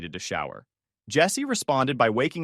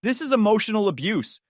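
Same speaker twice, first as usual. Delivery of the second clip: high mock shriek; 9 semitones higher, 3 dB louder than usual. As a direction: shriek it in outrage. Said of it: "Chloe shrieked".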